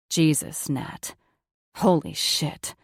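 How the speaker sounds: lightly voiced, strained lover; tense man's voice. A narrator's reading. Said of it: "sound of tension that had filled his voice".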